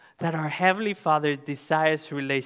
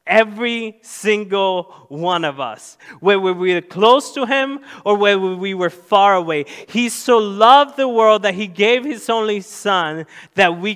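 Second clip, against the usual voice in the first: full-force preaching; 5 semitones higher, 6 dB louder than usual. Acted. Pastor preaching to the congregation, full-force preaching. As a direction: preach it out to the whole room.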